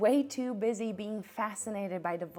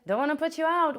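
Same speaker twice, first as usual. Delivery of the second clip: mocking quote; 7 semitones higher, 5 dB louder than usual. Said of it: "I can already hear the".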